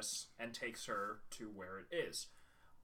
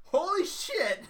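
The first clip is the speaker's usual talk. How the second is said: loud, high-pitched astonishment; amazed outburst; 11 dB louder, slower than usual. Real, not acted. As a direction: burst out laughing in disbelief. Exclaiming at the reveal.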